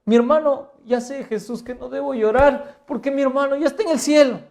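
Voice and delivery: preacher parodying a mistaken belief, loud and high; mock wrong quote. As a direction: act out what she did not say, overdone. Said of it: "No dijo".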